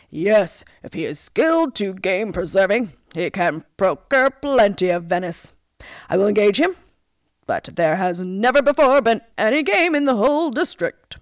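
loud royal pronouncement; storybook monarch granting a post; commanding king. A narrator's reading. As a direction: speak with a king's booming authority. King replies to the huntsman.

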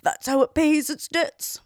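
worn-out faithful dog complaining; old hound voice. Acted. Hound speaks in tale.

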